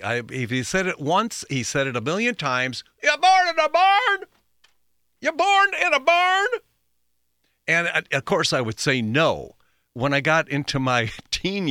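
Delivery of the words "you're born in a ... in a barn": high, loud mock-dad holler; scolding dad. Imitating dad's incessant nagging of his child, high scolding shout. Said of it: "my dad, I, I incessantly".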